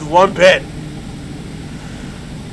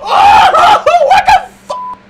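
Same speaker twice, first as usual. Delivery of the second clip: scared laughing shout; 5 dB louder, much slower than usual. Real, not acted. After a jumpscare.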